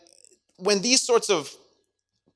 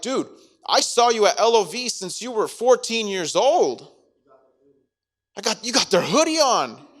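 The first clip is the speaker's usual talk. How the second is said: higher, louder teasing call-out; mock exposing a friend, higher and louder; exasperated accusation; 7 semitones higher, 7 dB louder than usual. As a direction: catch him red-handed, loud. Acted friend exposing Peter.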